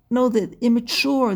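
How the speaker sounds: usual speaking voice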